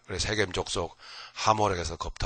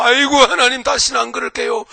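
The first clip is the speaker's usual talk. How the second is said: much higher and louder, a mock tearful wail to God; wailing plea; 14 semitones higher, 12 dB louder than usual. A believer in trouble crying to God, wailing loudly. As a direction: beg God through mock sobs, crying out. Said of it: "펑펑 울면서".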